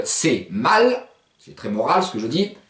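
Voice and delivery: usual speaking voice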